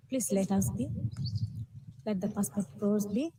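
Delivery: ordinary delivery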